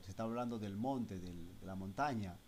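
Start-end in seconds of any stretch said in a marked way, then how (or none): none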